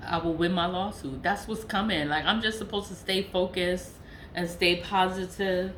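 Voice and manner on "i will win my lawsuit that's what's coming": ordinary talk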